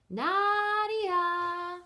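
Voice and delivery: long sung-out call across the village. A narrator's reading. Family calling Nadia home, long high sung call. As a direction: call her name like a parent from the doorstep.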